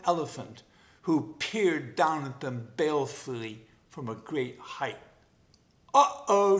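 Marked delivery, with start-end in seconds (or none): 5.9-6.6 high, loud alarmed yelp; a startled cub yelps out a warning; jackal cub shout. Acted. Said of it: "shouted the jackal cub".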